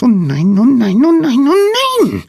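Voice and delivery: very high, loud squeaked lament; tiny voice climbing into a squeal; squeaky panicking little creature. A narrator's reading. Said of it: "piepste jemand".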